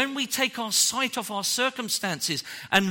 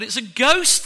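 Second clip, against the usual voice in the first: mock frightened cry; 4 semitones higher, 7 dB louder than usual. Disciples crying out in fear.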